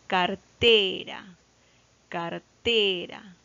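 "cartera" slowed right down, TE drawn out; deliberate "car-TEEE-ra" for a class; stress on TE. Shown as a demonstration. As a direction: hold the "te" in "cartera", falling away on "ra". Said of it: "en la penúltima sílaba".

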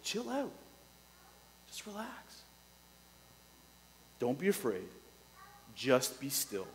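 soft, gentle reassurance; God telling his frightened people to relax, softly; God reassuring. Retold or imitated. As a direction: hush them like a calm father. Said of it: "You can just hear him saying".

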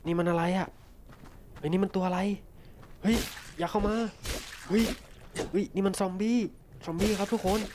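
freaking out at a zombie; startled. Real, not acted.

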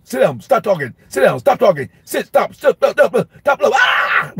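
high-pitched rapid-fire commands climbing into a scream; angry snapping. Retold or imitated. Exasperated teacher to an unruly class all day, angry snapping. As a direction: hammer out short orders until they break into a yelp. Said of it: "angry, snappy".

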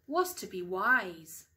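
lively storytime voice with big rises on the wishes; bouncy picture-book narration, hushed at the end; reading to children. Acted. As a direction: read it like bedtime storytime for young kids. Mum reads picture book aloud.

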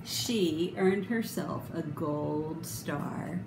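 usual speaking voice

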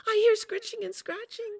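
a jittery pup blurting it out; Little Wolf. Acted. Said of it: "said Little Wolf".

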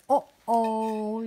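plain reading voice

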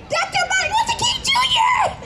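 put-on a little girl squealing the name up very high; thrilled child shouts out her hero's name; excited girl. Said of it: "I was so excited".

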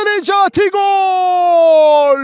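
screaming football commentator. Real, not acted. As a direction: stretch the goal call out and yell it. Goal scored, live commentary.

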